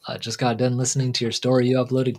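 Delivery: normal talking voice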